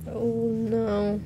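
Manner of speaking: ordinary talk, nothing marked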